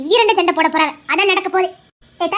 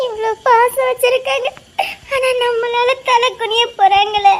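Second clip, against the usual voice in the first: higher-pitched weeping, drawn out at the end; tearful lament; 4 semitones higher, slower than usual. Acted. Woman dreading the shame for loving family, tearful lament. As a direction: weep it out, slowing as it goes.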